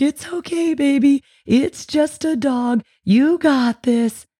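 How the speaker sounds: put-on high, slow soothing baby talk. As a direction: coo calmly to a scared baby. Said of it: "you're kind of freaked out".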